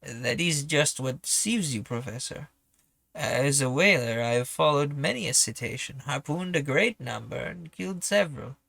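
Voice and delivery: put-on amateur French accent for a doubting whaler; terrible French accent. Whaler explains his doubts to the professor, terrible French accent. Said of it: "You'll have to excuse my terrible French accent".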